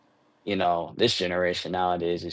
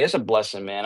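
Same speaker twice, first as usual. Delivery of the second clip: ordinary talk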